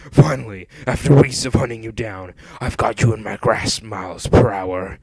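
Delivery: loud, gloating villain; triumphant cartoon villain voice; Eggman. A narrator's reading. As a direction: crow over a captured prey. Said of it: "cackled Eggman".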